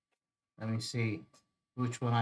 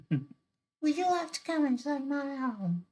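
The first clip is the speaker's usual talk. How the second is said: very high put-on mermaid voice. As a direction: squeak it out as a hammed-up mermaid. Redoes mermaid's line in character voice.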